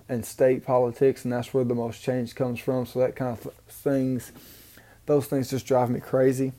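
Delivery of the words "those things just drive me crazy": normal talking voice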